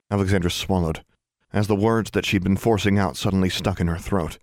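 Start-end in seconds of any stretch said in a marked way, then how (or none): none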